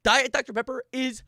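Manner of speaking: emphatic rant. Real, not acted. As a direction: spit out the disgust. Ranting about diet soda.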